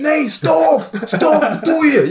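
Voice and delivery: very high, loud imitation of panic; mock panicked yelling. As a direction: yelp it like the alarmed opponent. Retold opponent begging him to stop.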